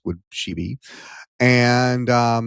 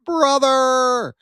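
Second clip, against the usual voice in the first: much higher squeal of brother; Felicia calling out; 12 semitones higher, 4 dB louder than usual. Retold or imitated. Host imitating Felicia greeting her brother, high squeal. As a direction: mimic her excited call, pitched way up. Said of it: "Felicia's now going".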